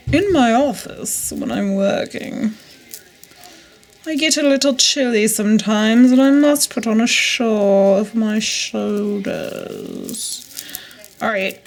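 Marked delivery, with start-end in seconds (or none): 0.1-10.4 slow, hammed-up posh voice on "office"; mock professional. Joking that her room is an office, mock posh. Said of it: "That sounds so professional".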